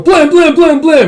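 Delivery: high loud mouth-made shots; gunfire noises. Retold or imitated. Narrator imitating gunfire. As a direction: spit out each shot.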